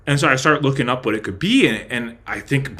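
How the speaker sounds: ordinary talk, nothing marked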